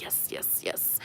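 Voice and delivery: breathy whispered yes yes yes; satisfied whisper. Retold or imitated. Plucking brows, whispering happy yeses to herself. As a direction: whisper it with quiet glee. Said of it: "and you're like".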